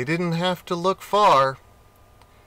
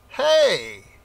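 high, loud call of discovery; cry; 7 semitones higher, 5 dB louder than usual. A narrator's reading. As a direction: shout to raise the alarm. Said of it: "he cried".

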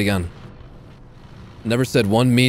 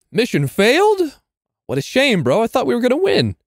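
mock disappointment; 12 semitones higher than usual. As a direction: tease the loss in a high voice. Mock disappointment after losing.

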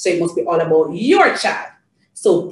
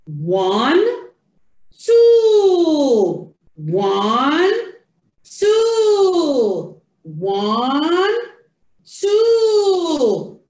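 rising "one", high falling drawn-out "two"; sing-song counting; 7 semitones higher, much slower than usual. Shown as a demonstration. Counting two animals with Janie, sing-song. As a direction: count in a stretched see-saw tune for a toddler.

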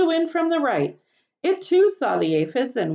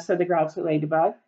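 plain reading voice

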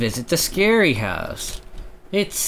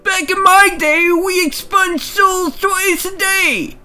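put-on old guy voice; 5 dB louder than usual. Voicing the old shopkeeper.

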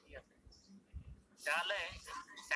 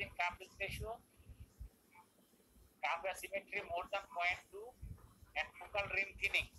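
slow dictation; much slower than usual. Real, not acted. Teacher dictating definition to class.

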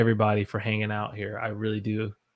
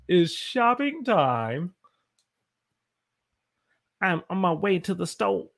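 slow, higher shopping time; Jim Carrey style; 6 semitones higher than usual. Pretend. Teacher repeating a phrase in goofy comic voice. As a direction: stretch out shopping time playfully. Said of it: "look more like Jim Carrey when I say that".

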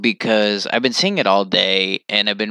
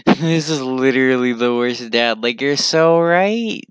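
usual speaking voice

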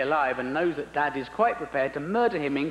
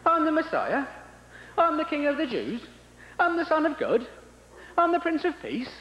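much higher, aggrieved whine; high, put-upon Messiah voice; whining Jesus; 11 semitones higher than usual. Acted. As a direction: list your titles in disbelief. Jesus complains on the cross.

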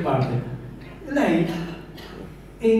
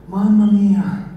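loud relieved sigh; 5 semitones higher, 5 dB louder, much slower than usual. Retold or imitated. Relief on the boat.

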